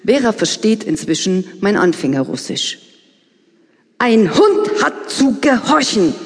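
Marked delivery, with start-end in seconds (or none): 4.0-6.1 slow, higher, emphatic retort; indignant. Acted. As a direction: lay down the rule, upset. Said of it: "entgegnet sie aufgebracht".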